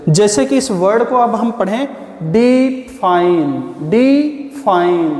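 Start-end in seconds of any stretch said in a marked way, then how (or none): 2.2-3.7 define slowly in two parts, held डि then easy fine; stressed-syllable demo. Shown as a demonstration. Teacher demonstrating stress to students, define slowly split in two. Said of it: "डी पे हमें ऐसा लग रहा है कि बंद करके बोल रहे हैं". 3.9-5.1 define very slowly, break after डि; stressed-syllable demo. Shown as a demonstration. Teacher repeating the word for students, define very slowly split. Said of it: "डी पे हमें ऐसा लग रहा है कि बंद करके बोल रहे हैं".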